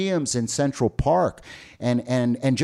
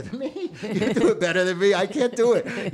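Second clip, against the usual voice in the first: laughing; 6 semitones higher than usual. Real, not acted. Laughing while asking partner.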